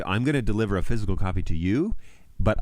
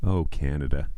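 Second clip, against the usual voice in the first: ordinary delivery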